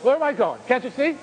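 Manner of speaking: mild imitation of a frightened man pleading; panicked. Strapped-in patient to the visitors he takes for undertakers, panicky. Terrified patient thinks he's dying.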